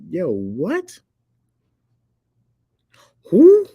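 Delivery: high incredulous questions, rising to a squeal; exaggerated comic disbelief; mock bewilderment. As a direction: pitch shooting up, totally baffled. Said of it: "I be like".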